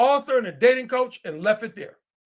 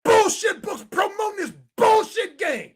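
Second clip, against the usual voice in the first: angry shout; 10 semitones higher than usual. Real, not acted. Ranting at the author about his book, angry shout.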